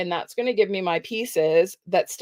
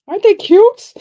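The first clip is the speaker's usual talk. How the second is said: much higher and louder, thrilled squeal of delight; gushing delight; 13 dB louder than usual. Real, not acted. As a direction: coo over how cute they are. Gushing over finished otter card.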